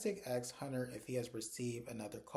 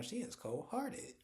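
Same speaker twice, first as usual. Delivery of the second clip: shocked disbelief; 10 semitones higher, 3 dB quieter, slower than usual. Retold or imitated. Her shocked reaction to the character.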